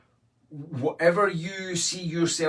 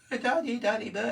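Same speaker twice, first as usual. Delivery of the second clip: put-on much higher, whining "daddy" like a small girl; imitating whiny daughter; 10 semitones higher than usual. Father imitating his wee girl gurning at him, high and whiny. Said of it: "she just gurns for no reason".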